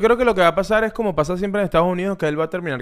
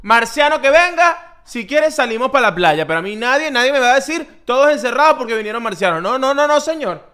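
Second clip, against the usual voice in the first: raised, high-pitched mock protest; defiant rant; 8 semitones higher, 4 dB louder than usual. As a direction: refuse loudly, as if nobody can stop you. Mock defiant rant against new lockdown.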